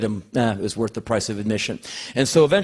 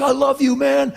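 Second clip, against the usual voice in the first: higher, louder fan yell; 13 semitones higher, 4 dB louder than usual. Retold or imitated. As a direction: shout your love from the crowd. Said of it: "People are yelling things at him".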